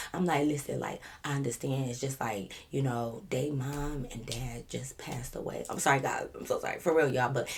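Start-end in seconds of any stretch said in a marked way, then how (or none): 0.5-5.6 low, hushed sympathy plea, sinking to a whisper; soft and confiding, fading low; calm and collected. Retold or imitated. Making excuses to the officer, calm hushed confiding. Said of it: "I try to be the calm, cool, collective one".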